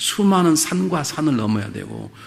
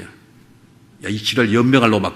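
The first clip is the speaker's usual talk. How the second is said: a Jeolla friend's rough swearing greeting, said louder; Jeolla dialect swearing; 5 dB louder, slower than usual. Retold or imitated. Jeolla man greeting a close friend with rough Jeolla dialect curses. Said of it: "전라도 말 욕이 나와버렸어요".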